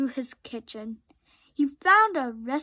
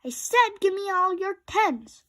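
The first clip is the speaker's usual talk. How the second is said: demanding; 7 semitones higher, 4 dB louder than usual. A narrator's reading. Rabbit demanding during card game.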